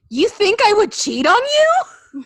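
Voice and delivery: high, loud mock outrage; affronted, pitch shooting up; indignant wife. Wife accused of cheating on husband, hammed-up indignation.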